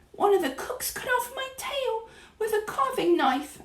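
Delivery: high, tiny mouse voice; whispered back. A narrator's reading. Mouse tells Grandmama about his cut tail, whispering back. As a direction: squeak it pitifully, lightly hushed. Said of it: "I whispered back".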